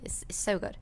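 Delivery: usual speaking voice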